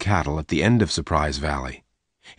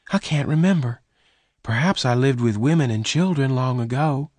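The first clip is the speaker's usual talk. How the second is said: put-on lightly raised, higher girl's voice; higher voice for the rustler's girl; young woman Bess; 7 semitones higher than usual. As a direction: voice Bess higher and gentle-toned.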